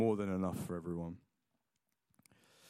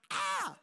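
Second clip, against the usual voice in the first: sudden very high pained yelp; shrill yelp. Retold or imitated. Son yelping as dad prods his foot, high squeal. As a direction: squeal as the foot gets prodded. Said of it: "I'm like".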